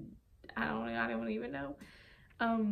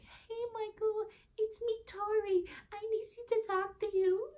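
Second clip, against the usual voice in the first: fake crying; 13 semitones higher, 3 dB louder than usual. Prank caller posing as Tori pleading with Michael, fake crying. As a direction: put on a whiny, crying prank-call voice. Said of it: "crying in the morning".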